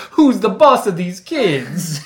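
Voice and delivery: loud, high laughing question. Real, not acted. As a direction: laugh through it. Joking, laughing.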